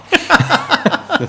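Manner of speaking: sudden high squeal of a laugh; high burst of laughter. Real, not acted. Laughing at his wife buying land with power of attorney, high burst of laughter.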